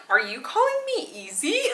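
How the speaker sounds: high, mock-offended question. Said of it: "is how I felt".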